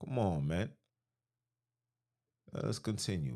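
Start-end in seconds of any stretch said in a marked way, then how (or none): none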